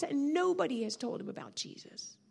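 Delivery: ordinary delivery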